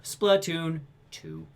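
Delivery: very slow, drawn-out mock translator; unexcited dub. Podcaster mocking the dubber beside an animated developer, very slow unexcited dub voice. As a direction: stretch the title out like a detached voice-over. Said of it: "the dub guy's still like".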